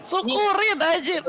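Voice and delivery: high-pitched gleeful taunt; gloating jeer. Real, not acted. Jeering at rival after laughing.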